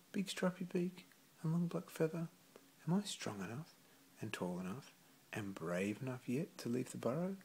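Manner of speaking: put-on high, small childlike voice; bright high chick asking his parents; little puffling voice. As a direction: ask it like an eager little chick. Puffling asks again, childlike voice.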